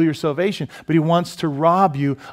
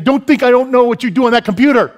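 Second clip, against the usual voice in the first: loud, high angry yell; acted furious accusation, pitched way up; accusing movie wife; 10 semitones higher, 9 dB louder than usual. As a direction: shout it like a wife confronting her husband. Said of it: "his wife was saying".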